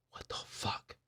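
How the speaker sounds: barely audible stunned whisper; whispered disbelief. Retold or imitated. Fan acting out bafflement at the stairs trick, whispered.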